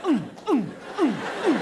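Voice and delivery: loud high retching grunts; mimicking a cat being sick.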